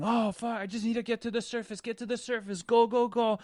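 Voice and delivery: very high, fast frantic pleading; desperate panic. Pretend. Surfer swimming for the surface, frantic high panic. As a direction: race to the surface with every word. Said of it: "surfer desperate for the surface".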